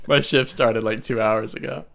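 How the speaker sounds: ordinary talk, nothing marked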